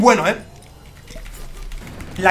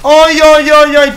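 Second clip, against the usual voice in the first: high, loud cries of ay ay; loud, high whoops over a crazy play; excited yelling; 5 semitones higher, 7 dB louder, slower than usual. Real, not acted. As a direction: yell in delighted disbelief. Wild reaction to game moment.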